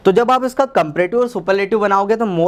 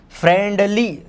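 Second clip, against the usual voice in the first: Friendly split into two stretched beats; deliberate two-part friendly; drawn out in two; 3 semitones higher, slower than usual. Shown as a demonstration. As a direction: pull friendly apart as friend, then lee. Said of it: "आपको दो बार खींचना पड़ा इसको".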